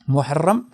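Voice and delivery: محرم said as muharram, the month name; first of three words. Shown as a demonstration. Teacher contrasting three look-alike words, saying muharram. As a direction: give محرم as muharram with a doubled r. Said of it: "ویسے یہ تین لفظ ہے".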